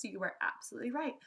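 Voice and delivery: ordinary delivery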